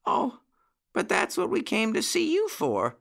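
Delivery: high-pitched little whine; whining puppy. A narrator's reading. Jackie came to beg Uncle Wiggily, high puppy whine. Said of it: "whined Jackie".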